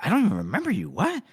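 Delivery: high, incredulous denial; acted clueless surprise; mock bafflement. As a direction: squeak up on the question in disbelief. [name] baffled at being recognized.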